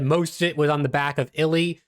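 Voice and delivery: usual speaking voice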